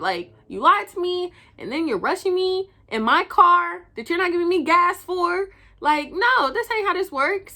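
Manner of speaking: high-pitched, indignant telling-off; heated rant. Retold or imitated. Retelling the row with her lying date, heated. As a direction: lay into him, worked up. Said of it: "I was heated".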